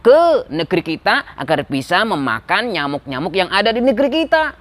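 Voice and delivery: lively minister pitching a plan; scheming minister. Acted. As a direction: sell the plan eagerly to the king. Menteri z zapałem przedkłada pomysł.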